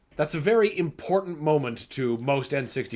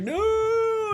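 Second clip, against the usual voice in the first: way higher, cartoon monster's panicked no; monster's squealed no. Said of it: "he's like".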